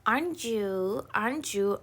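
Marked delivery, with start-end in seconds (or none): none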